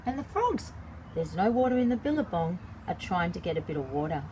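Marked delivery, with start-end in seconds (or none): none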